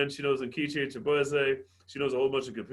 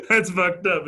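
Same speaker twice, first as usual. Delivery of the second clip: loud, talking through a big laugh; real laughter bursting into the words; 10 semitones higher, 9 dB louder, much slower than usual. Real, not acted. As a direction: barely get it out while cracking up. Host cracking up at the roast.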